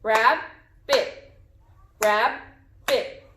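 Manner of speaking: "Rabbit" in two even beats, rab, bit; clapped syllables. Shown as a demonstration. Teacher claps the word with pupils, "Rabbit" split rab, bit. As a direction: chop "Rabbit" at the syllable break with a pause. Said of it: "Let's clap the word".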